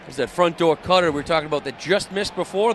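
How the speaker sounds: ordinary delivery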